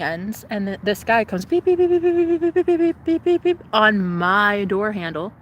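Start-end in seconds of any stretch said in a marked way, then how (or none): 1.6-3.6 high, loud imitated beeping; keypad beeps. The stranger at her door, imitated high keypad beeps. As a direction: mimic a door code pad beeping.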